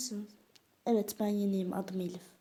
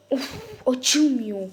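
exasperated; 4 semitones higher, 9 dB louder, much slower than usual. Pretend. Door won't open.

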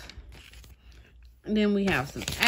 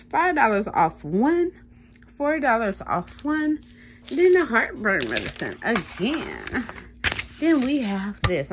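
ordinary delivery

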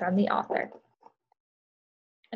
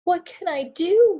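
high, slow, tearful little rabbit; sobbing rabbit; 10 semitones higher, 8 dB louder, slower than usual. Acted. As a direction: plead like a frightened small rabbit. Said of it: "sobbed Rabbit".

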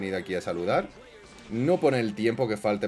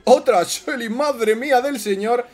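excited shout; 12 semitones higher, 6 dB louder, slower than usual. Real, not acted. New unit from chest.

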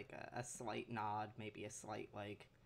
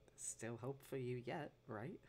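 soft, gently questioning encouragement; quiet reassurance with a questioning lift; lowered voice; 5 dB quieter than usual. Acted. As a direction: keep it hushed, lifting into a tender "right?". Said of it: "speaking in a, a lower voice".

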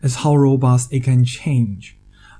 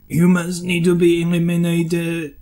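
robot voice. Pretend. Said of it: "I'm sorry for the stereotype robot voice".

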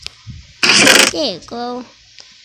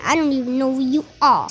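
ordinary delivery

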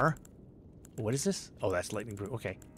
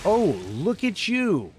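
surprised; 8 semitones higher, 6 dB louder than usual. Real, not acted. Surprised greeting in game.

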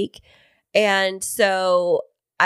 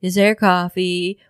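groggy coffee plea; slower than usual. Retold or imitated. Husband voiced wanting coffee.

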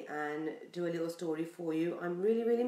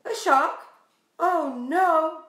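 hammed-up, louder and higher alarm; mock alarm; 7 semitones higher, 8 dB louder, slower than usual. Reader acts alarmed for the children, loud and high. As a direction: play scared for the kids.

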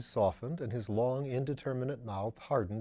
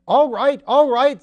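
much higher, much louder screaming to a deaf caller; screamed; 13 semitones higher, 15 dB louder than usual. A narrator's reading. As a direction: scream it down the line, twice. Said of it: "At length he screamed".

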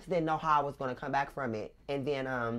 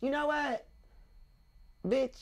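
sassy; 11 semitones higher than usual. Retold or imitated. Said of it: "And I was like".

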